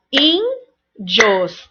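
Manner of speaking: "índios" split into ÍN, pause, DIOS; slow teacher's split of "índios"; syllable by syllable. Shown as a demonstration. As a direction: break "índios" into two separate syllables. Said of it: "Pra não restar dúvida".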